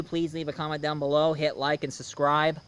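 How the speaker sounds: ordinary talk, nothing marked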